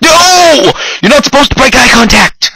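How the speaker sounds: loud scolding shout. Real, not acted. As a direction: yell it in protest. Scolding himself in game.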